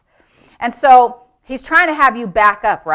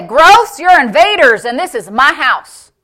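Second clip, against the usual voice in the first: raised, loud voice easing at the end; commanding rebuke; 4 semitones higher, 9 dB louder than usual. Acted. Norval addresses the growths in his house, sternly commanding. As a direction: order out an intruder with authority.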